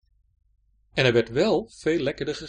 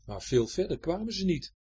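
ordinary delivery, nothing marked

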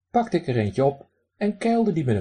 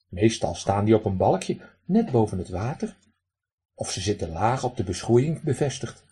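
ordinary delivery, nothing marked

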